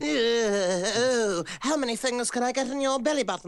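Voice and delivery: high, excited creepy voice; creepy villain voice. Acted. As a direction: leer and giggle as the attacker.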